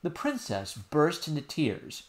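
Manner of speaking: usual speaking voice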